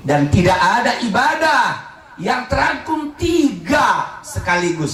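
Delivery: high, ringing declaration to a congregation; emphatic preaching. Real, not acted. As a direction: proclaim it from the pulpit, stretching key words. (khotbah lantang dan tegas)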